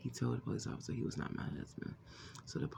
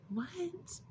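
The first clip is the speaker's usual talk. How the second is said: stunned what. Retold or imitated. Shocked at his accusation.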